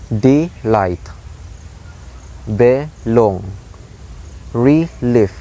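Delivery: "delight, belong, relief" split, second syllable stressed; iambic stress. Shown as a demonstration. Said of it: "the sound is like the dum".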